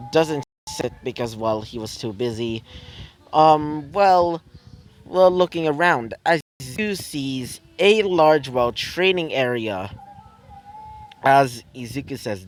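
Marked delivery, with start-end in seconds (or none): none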